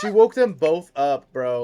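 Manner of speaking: exasperated outburst. Real, not acted. Gamer scolding her Sim, loud and exasperated. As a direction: blurt it out in disbelief. Babies woken, Sims misbehaving.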